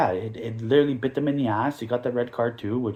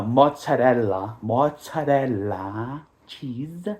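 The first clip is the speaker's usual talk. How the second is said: slow mock drawl; much slower than usual. Joking mispronunciation of Monza.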